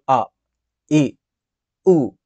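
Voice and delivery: ఆ, ఈ, ఊ each held as one steady vowel; pure vowel sounds. Shown as a demonstration. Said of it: "ఇలా అన్నమాట". (ఆ, ఈ, ఊ ఒక్కో అచ్చును ఒకే శబ్దంగా పలకడం)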